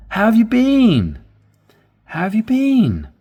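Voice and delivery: eager friend asking; excited. Shown as a demonstration. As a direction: go up to sound thrilled. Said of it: "When you go up, it's more excited".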